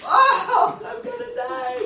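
very high, loud mock terror; terrified Peter. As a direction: squeal it like a scared fisherman.

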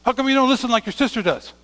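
scolding parent. Acted. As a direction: scold a son for not listening. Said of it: "it's like you telling your son".

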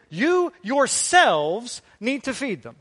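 YOURSELVES hit high, loud and drawn out; emphatic command. Retold or imitated. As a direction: punch "You yourselves" slowly, at full preaching volume. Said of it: "emphatic to us today".

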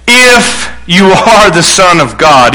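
"If" drawn out slowly, then a pause; stress on If. Acted. Satan tests Jesus with doubt, long stress on "If". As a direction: lean hard and long on "If". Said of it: "continued to use the word if".

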